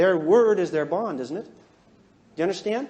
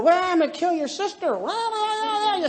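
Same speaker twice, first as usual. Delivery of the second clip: high, stretched-out rant; mock raging; 9 semitones higher than usual. Raging red threatening a calm sister-in-law, mock raging. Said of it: "You can be a raging red".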